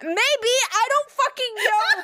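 high, giggling all through; laughing as she talks. Real, not acted. Recounting the doctor's pants to a friend, giggling.

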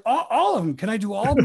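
high, eager mock pleading; eager plea. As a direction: act out being tickled by every option. Retells giggling plea to pick everything.

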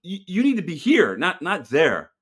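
retold rant. Telling rally-goers the rally is here, a loud high rant. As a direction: throw it back at them, loud and high. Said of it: "I, I'd be like".

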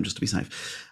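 hushed, secretive whisper; whispered aside. Real, not acted. Podcast host to co-host, whispering to stay safe. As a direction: drop to a whisper just to be safe. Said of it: "whispered aside, not saying name".

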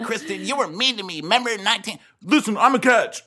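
high, whiny imitation of the cast; high mock whine. Mocking castmates whining at Kristen, in a high voice. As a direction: squeak it out like a petty castmate. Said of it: "They'd be like".

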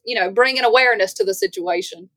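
ordinary delivery

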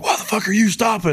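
high, mock-booming army yell; hammed-up drill sergeant imitation; drill sergeant bark. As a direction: shout it like a drill sergeant at a lifter. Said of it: "his booming Army drill sergeant voice".

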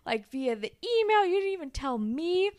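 high, mock-indignant accusation; bickering. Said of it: "kind of awkward fight".

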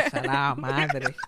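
low, very slow muttered curse; grumbled curse. Retold or imitated. Cursing at being sent outside, low and slow. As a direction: drag it out under your breath. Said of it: "Y yo".

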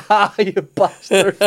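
high, drawn-out mock outrage; mock indignation. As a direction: draw it out high, half laughing. Acted reaction to losing his daughter.

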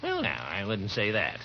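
slow, mild mock of the druggist's hedge; mocking Peavy. Gildersleeve mocks the pharmacist's stock dodge, slow mimicry. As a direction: parrot his cautious dodge, slowly.

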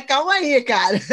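amused, half-laughing protest; laughing disbelief. Retold or imitated. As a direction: laugh through the words. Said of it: "E eu fico".

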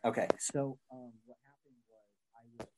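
faint and muffled, far away; covered mic. Real, not acted. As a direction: sounds like speaking from a fishbowl. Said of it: "And then you covered it up again".

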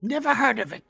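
high creepy voice talking to itself; paranoid, questioning dark creature; eerie creature voice. Acted. Creature talks to Toby and party.

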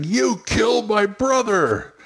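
high, slow angry accusation; accusing player. Shown as a demonstration. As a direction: hurl it at the villain. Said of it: "the players are saying".

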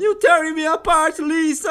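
high, slow overacted movie line; Tommy Wiseau quote. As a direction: ham it up like a bad melodrama. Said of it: "que é o cara falando".

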